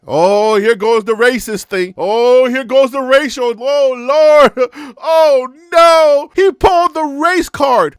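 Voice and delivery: loud mock viewers jeering about the race card; mock critics. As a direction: ham up the outraged commenters. Mocking imagined critics.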